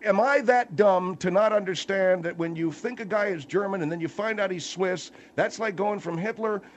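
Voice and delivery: high-pitched sarcastic comeback; incredulous. Real, not acted. Host repeating guest's logic back to him, incredulous.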